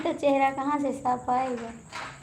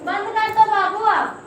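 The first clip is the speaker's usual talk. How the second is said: calling out; 8 semitones higher, 6 dB louder than usual. Real, not acted. Calling out to a child off-camera.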